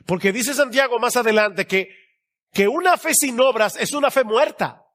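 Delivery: high, ringing sermon voice; emphatic preaching. Real, not acted. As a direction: hammer the warning home to the congregation.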